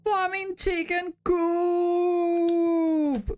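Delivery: high, loud, drawn-out screech; screeching battle cry. Acted. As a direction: screech it while charging. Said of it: "He screeched as he charged".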